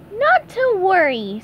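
carefree squeaky sponge, slow; breezy high cartoon reassurance; easy high cartoon voice. SpongeBob reassures Sam in a breezy high cartoon voice. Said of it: "said SpongeBob easily".

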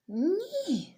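high, loud little cry "ні!"; frightened cry. Acted. Bunny scared by the owl's hooting, high frightened cry. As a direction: squeal in fright. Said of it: "злякано говорить зайка".

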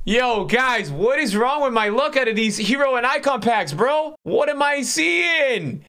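high, drawn-out what am I seeing; can't believe the pack luck; stunned disbelief. Real, not acted. Shocked by bad pack results.

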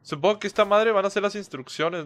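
usual speaking voice

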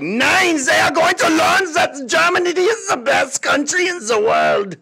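high, shouted mock German rant; mock ranting Hitler. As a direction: bark it like a raving dictator. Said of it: "Hitler's like".

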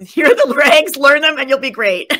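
loud, high laughter breaking through the words; laughing. Real, not acted. Recalling her advice to Don, laughing loudly. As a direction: laugh your way through the remembered line.

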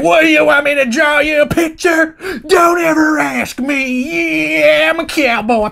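high, strained cowboy holler; mock angry outburst. Uncle refusing to describe what happened, angry holler. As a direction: erupt in a hammed-up shout, stretching the words. Wayne erupts when pressed for details.

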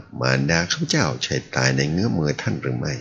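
ordinary delivery

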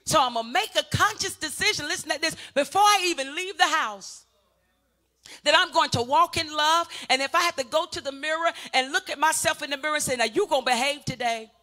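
rousing pulpit delivery; preaching at full force. Acted. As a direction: preach it out to the whole church. Pastor preaching to congregation.

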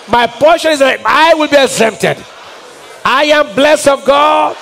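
full-force preaching. Acted. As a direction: preach it over them with rising fire.

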